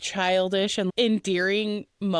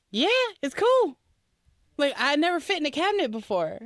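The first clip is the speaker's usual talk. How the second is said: higher, quick cheerful chatter; happy boyfriend; 5 semitones higher, faster than usual. Retold or imitated. Boyfriend delighted to fit in a cabinet, high quick cheer. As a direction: chirp it like a delighted kid. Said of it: "And he's like".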